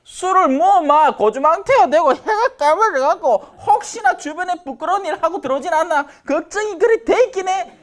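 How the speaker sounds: high, worked-up wifely fretting; fussing wife. Acted. Wife worrying over her drunk husband, high mock fussing. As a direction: fret in a high put-on voice. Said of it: "난리를 피우는 거지".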